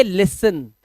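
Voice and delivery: लिसन with no T sound, just an S; silent T. Shown as a demonstration. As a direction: say लिसन, dropping the T entirely. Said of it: "तो इसे पढ़ेंगे".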